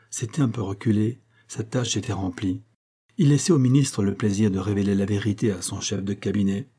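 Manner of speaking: ordinary delivery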